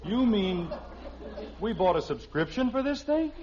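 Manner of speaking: high, loud disbelief; can't believe what he hears; shocked husband exclaiming to wife; incredulous. Acted.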